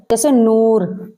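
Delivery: नूर हूर मंजूर with a long full oo; clear long oo. Shown as a demonstration. Teacher demonstrating the long oo sound to students. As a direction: stretch the oo in नूर. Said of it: "खूब जाहिर करके पढ़ी जाए".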